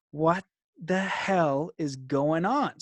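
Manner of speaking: slow, drawn-out bewildered question; stunned disbelief. Retold or imitated. Man left baffled as she walks off, slow disbelief. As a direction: let each word hang in shock.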